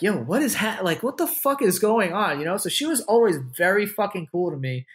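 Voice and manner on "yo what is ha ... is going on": higher, bewildered yo what is going on; mock disbelief from the room; stunned crowd. Onlookers watching her give her number, high and bewildered. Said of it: "everyone was just like".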